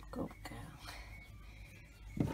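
soft whispered praise. Real, not acted. Owner whispering praise to her pet Lola, whispered. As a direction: breathe it out as a whisper to calm her.

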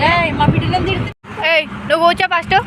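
high, loud coaxing. Real, not acted. Telling kids to sit and eat. (పిల్లలను తినమని బుజ్జగించడం)

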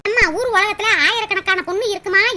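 ordinary talk, nothing marked

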